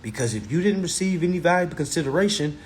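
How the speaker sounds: ordinary talk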